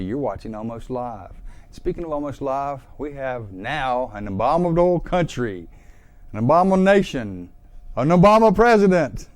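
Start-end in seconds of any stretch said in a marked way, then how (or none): 3.0-9.3 building mock proclamation. Mock-grand build-up to a pun.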